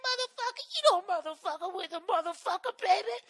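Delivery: high, shrill-free falsetto street woman cursing; loud cleaning lady impression. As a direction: belt it out as a loud housekeeper. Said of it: "I just hear this lady telling this story".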